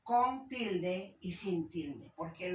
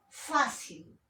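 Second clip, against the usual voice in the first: "Fácil" with a high first syllable; stressed-syllable demonstration; 5 semitones higher than usual. Shown as a demonstration. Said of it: "penúltima".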